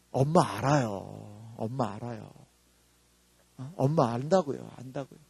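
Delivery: mother softly reassuring her daughter; tender mother. Retold or imitated. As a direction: gently reassure a sulking child.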